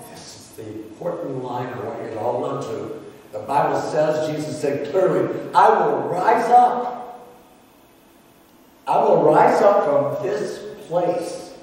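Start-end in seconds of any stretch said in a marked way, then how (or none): 5.5-11.5 slow, louder vow of a broken son; resolute son. Acted. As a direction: proclaim it like a promise to himself. Son resolves to return home.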